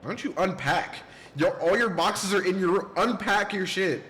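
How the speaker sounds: loud, high exasperated nag; mock nagging. Player scolding the character to unpack, loud mock nag. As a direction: yell at the lazy kid to unpack, hammed up.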